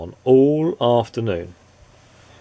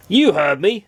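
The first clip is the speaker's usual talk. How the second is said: sharp, higher, louder snap; snapped; 8 semitones higher, 4 dB louder than usual. A narrator's reading. Grumpy neighbour snapping at a visitor, sharp and louder. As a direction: bite the words off at him. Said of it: "snapped Mister Grumpy".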